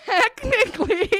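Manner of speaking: high, laughing through the word technically; laughing sheepish. Retold or imitated. Conceding the quote to the fact checker, sheepish laugh. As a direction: admit it while giggling. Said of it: "and I was like".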